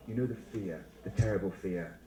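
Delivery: hushed, low, ominous build-up; mock dread. Teacher naming teachers' terrible fear of silence, mock ominous.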